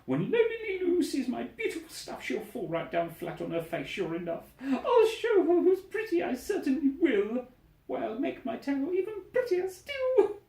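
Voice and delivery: very high, giddy gloating with laughs; gloating bird. Acted. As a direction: squeal and crow over your rival. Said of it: "and then Gertrude yelled".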